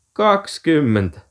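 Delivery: scoffing, disbelieving repeat; mocking disbelief. A narrator's reading. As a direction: throw the number back as if it were absurd. Boys echo the figure, then guffaw.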